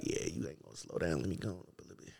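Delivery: low whispered aside to himself; whispered thought. Retold or imitated. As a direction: mutter it under your breath. Retold inner thought, whispered.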